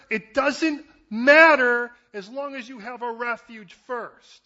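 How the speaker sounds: loud, high, emphatic sermon climax; driving, punched phrase after phrase; full-force preaching. Acted. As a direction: preach with mounting force and conviction.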